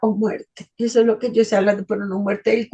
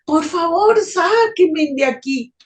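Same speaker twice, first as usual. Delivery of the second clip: desperate plea; 6 semitones higher than usual. Retold or imitated. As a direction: beg to be pulled out. (súplica desesperada, más aguda)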